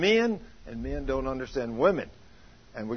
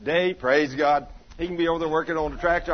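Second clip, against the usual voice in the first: ordinary talk, nothing marked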